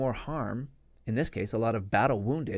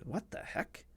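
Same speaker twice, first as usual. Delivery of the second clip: whispered surprise; 5 semitones higher, 13 dB quieter, slower than usual. Retold or imitated. Finding body on road.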